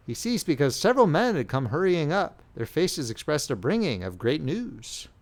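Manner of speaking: usual speaking voice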